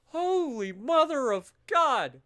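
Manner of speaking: high, slow, drawn-out awe; awed exclamation. Real, not acted. Streamer marvelling at a new casualty record, slow awed exclamation. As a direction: savour the shock.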